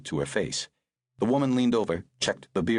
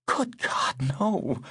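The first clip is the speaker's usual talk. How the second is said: agonized; 11 semitones higher, much slower than usual. Acted. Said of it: "a kind of agony there".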